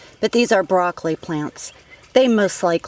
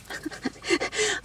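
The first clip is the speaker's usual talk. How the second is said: nervous laugh; 11 dB quieter than usual. Real, not acted. Laughs after flinching at ants.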